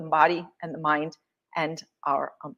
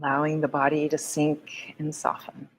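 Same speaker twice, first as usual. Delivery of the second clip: guided meditation; 4 semitones lower than usual. Acted. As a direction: ease them into stillness, gently.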